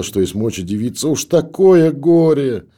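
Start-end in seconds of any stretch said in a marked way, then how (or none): 1.1-2.7 very high, loud and slow wail. A narrator's reading. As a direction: drag out the grief in a howl. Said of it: "заревела". (протяжный громкий плач на высоких нотах)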